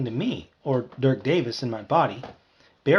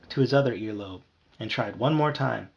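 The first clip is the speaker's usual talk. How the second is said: ordinary delivery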